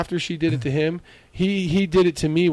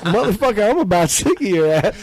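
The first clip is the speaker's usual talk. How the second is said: fed up anger; 5 semitones higher than usual. Retold or imitated. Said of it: "I'm like".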